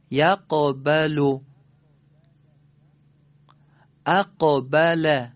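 "یقبل" and "اقبل" slow and loud, each qaf bounced before the ba; qalqala mid-word. Shown as a demonstration. Teacher gives students Quranic examples, "یقبل" and "اقبل" with bounced qaf. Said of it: "کلمات قرآنی متعدد داریم که این حالتو داره".

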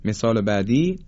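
ordinary talk

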